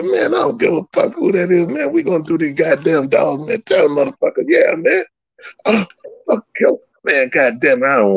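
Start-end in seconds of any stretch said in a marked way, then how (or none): 0.0-6.8 high-pitched hyped-up rant, retold; fired-up retelling. Retelling how he told his friend to take the match, high and hyped. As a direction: pump everyone up, voice up high.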